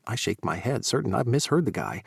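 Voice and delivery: ordinary delivery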